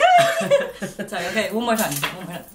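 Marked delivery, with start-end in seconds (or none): 0.0-1.1 very high, loud, giggling "Hi"; exaggerated high-pitched hello, breaking into laughter; mock squeaky greeting. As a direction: squeal "Hi" as a silly self-imitation. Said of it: "I don't talk like that".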